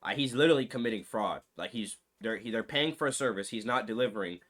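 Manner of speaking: low serious aside about fraud. Real, not acted. Grave point about jail, fraud.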